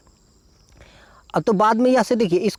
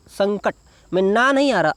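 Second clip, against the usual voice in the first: ordinary talk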